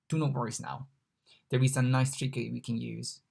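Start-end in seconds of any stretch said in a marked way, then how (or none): none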